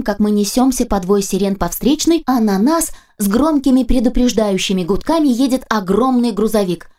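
usual speaking voice

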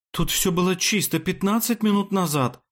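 high-pitched, indignant dismay; appalled outcry. A narrator's reading. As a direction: cry out high in horror at the mess. Horrified maid finds the mess. (возмущённый вопль ужаса, высоким голосом)

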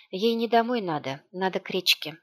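plain reading voice

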